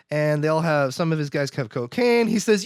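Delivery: ordinary talk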